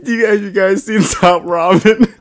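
high squeaky giggling speech; laughing while talking. Real, not acted. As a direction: fight through the giggles. Laughing through the line.

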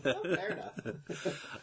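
drawn-out, slow, half-laughing acceptance; slow mock acceptance. Roommate told to move out, slow mock acceptance. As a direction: let it out slowly with a laugh.

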